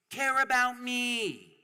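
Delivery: high, slow, whimpering plea; mock plea for care. Speaker mocking the powerful begging for care, high and slow. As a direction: beg pitifully as a mock. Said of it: "they go".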